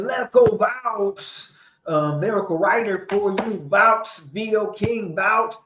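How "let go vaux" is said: high, slow mock call of the name; lightly acted shout-out; imitating Lou Wade. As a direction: stretch it out like a hype man. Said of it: "Lou Wade said".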